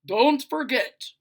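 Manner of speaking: slow, loud bossy father; father's orders. Acted. Father barking chores at his daughter, slow and loud. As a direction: lay down the law slowly.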